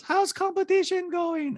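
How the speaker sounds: put-on very high-pitched accented caricature; squeaky falsetto mimicry of a reality star; Diana impression. Host mimics reality star in recap, high-pitched caricature. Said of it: "Diana goes".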